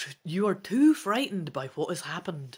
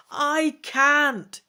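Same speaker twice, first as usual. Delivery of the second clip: loud, higher cry; screamed back; 10 semitones higher, 9 dB louder than usual. A narrator's reading. Trion screams back at Rhinath, loud. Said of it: "you scream back".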